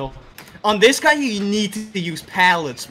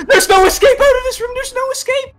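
panicked; 10 dB louder than usual. Real, not acted. Killer already in the room.

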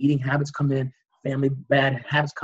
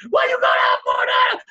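very high, loud frenzied shouting; frantic yelling; 5 dB louder than usual. Acted. Hospital staff all yelling as patient arrives, frantic yelling. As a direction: yell over the emergency room chaos. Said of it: "They're all yelling and shit".

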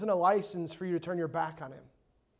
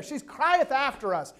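higher, slow mock complaint; annoyed disciples; 6 semitones higher, 4 dB louder, slower than usual. Disciples grumbling to the Lord about her, annoyed. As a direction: imitate the disciples' grievance, drawing it out. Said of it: "essentially what they're saying there".

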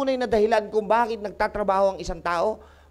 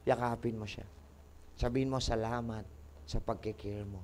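low, very soft reassurance; gentle counsel; 9 semitones lower, 12 dB quieter than usual. Real, not acted. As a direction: drop to a hushed, tender explanation. Gently explains that scolding means love.

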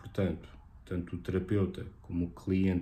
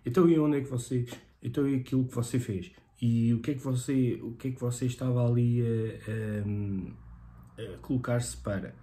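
lightly acted rapid-fire questioning, pitched higher; mock interrogating therapist; 4 semitones higher than usual. Therapist firing endless questions at a client, mock interrogation. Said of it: "nós não fazemos quinhentas perguntas".

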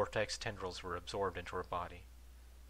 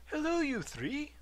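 cheery; 5 dB louder, slower than usual. A narrator's reading. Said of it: "he said cheerily".

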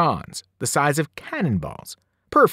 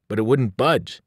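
plain reading voice

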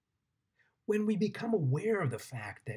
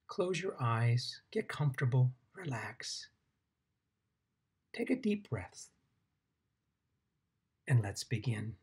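guided meditation. Acted. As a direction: guide them gently into the ground, unhurried.

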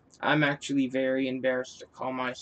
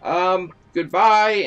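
drawn-out goodbye; 2 semitones higher, 7 dB louder, slower than usual. Real, not acted.

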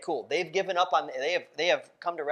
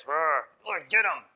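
mock angry growl; 3 dB louder than usual. Acted angry prayer for justice.

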